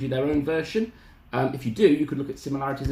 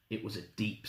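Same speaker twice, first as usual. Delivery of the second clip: ordinary delivery, nothing marked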